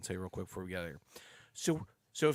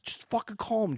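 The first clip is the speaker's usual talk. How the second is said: mock snap; 13 semitones higher, 6 dB louder than usual. Jeff snaps at him, retold.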